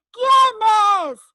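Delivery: loud, high exclamation; grito. Acted. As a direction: shout it out in alarm at the news. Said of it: "y tú gritas". (exclamación a gritos, fuerte y aguda)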